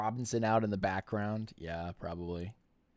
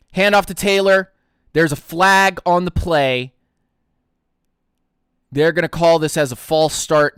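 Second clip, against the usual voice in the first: punchy, louder game call; live play-by-play; 4 semitones higher, 7 dB louder than usual. Real, not acted. Streamer calling a Colts run for viewers, live play-by-play.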